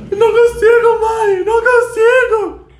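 very high, loud desperate scream; hammed-up cry of a girl who can't escape; panicked shout. Imitating the Halloween girl. (grito desesperado de filme de terror)

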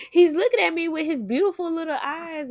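mother gushing over her newborn, delighted. Real, not acted. Delighted gush about the baby.

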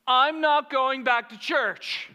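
high, loud, slow complaint; hammed-up wail of hurt feelings; mock hurt outburst. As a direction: imitate a childish, wounded protest. Mocking the hurt woman's complaint.

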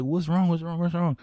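high, repeated worried question; retold alarm. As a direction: act out parents frantically asking. Retold panicked parents asking son.

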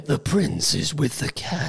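slow, soft, creepy reading voice; sinister whisper. Retold or imitated. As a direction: read it low and sinister. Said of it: "this sinister voice reads it".

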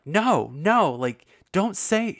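high, loud mock scolding; imitating a man snapping at the host; mock angry guy. Podcast guest scolding the host, imitated in a loud high voice. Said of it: "started getting really mad at the host".

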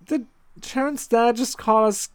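high, loud disbelief; surprised. Real, not acted. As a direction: ask in shocked disbelief. Surprised by the dad.

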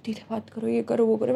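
soft, hushed telling-off; hushed instruction. Retold or imitated. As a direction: shush people quietly. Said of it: "बोलती".

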